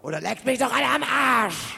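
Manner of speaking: furious shout. Acted. Told to stay calm, he screams it anyway. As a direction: blow up and scream it out.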